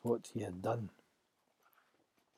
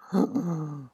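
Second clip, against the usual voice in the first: sharp gasp of horror; shocked gasp; 4 semitones higher than usual. Acted. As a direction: gasp as the secret is out. Moses realises crime known.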